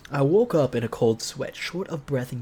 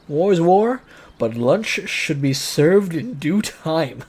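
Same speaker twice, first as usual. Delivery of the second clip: louder, higher, slow mock-heroic proclamation; dramatic declaration; 3 semitones higher, 7 dB louder, slower than usual. Gamer reading the protagonist's line as a grand slow proclamation. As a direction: declare it like a war speech, slowly.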